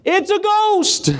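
high, slow, frightened wail; terrified cry. Acted. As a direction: shriek it like you've seen a ghost. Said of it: "they were troubled".

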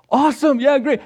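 put-on thrilled child.

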